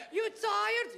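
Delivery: high-pitched mom voice, questioning; high mock-mother question; immigrant mother. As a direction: play the immigrant mother checking on her son. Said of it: "come home and tell my mom".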